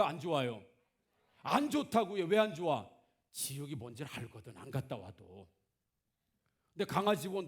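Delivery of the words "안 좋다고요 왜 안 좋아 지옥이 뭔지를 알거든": normal talking voice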